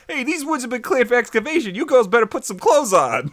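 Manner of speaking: loud, high mock Brooklyn accent. Bulldozer driver warning girls, hammed-up Brooklyn accent. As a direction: belt it out in cartoon Brooklynese. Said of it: "With his Brooklyn accent".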